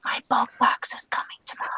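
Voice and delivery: hushed secretive whisper. Real, not acted. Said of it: "Why are we whispering?".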